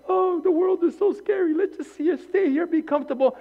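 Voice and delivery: high, fearful mock voice; mock scared believer. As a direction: mimic a timid believer clinging to safety. Mocking fearful churchgoers.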